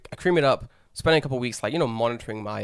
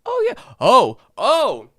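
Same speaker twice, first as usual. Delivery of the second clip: much higher, louder, slow gasping oh oh oh; shocked shop woman; 9 semitones higher, 8 dB louder, much slower than usual. Retold or imitated. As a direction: ham up the shop woman's alarm at the wound. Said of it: "she goes".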